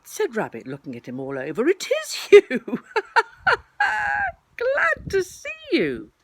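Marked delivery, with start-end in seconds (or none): 1.7-6.1 high, loud, laughing welcome; laughing greeting. Acted. Rabbit recognizing his friend Pooh, laughing. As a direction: break into a big high laugh as you greet.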